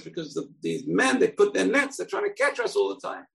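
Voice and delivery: the fish explaining its fear to the fox; anxious little fish. Acted. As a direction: squeak out the worry. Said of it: "the fish says".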